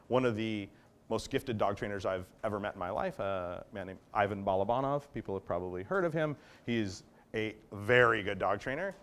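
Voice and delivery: ordinary delivery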